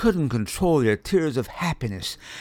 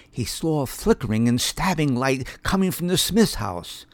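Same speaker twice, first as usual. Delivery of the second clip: usual speaking voice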